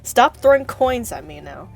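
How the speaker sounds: mock scolding. Scolding chat for coins.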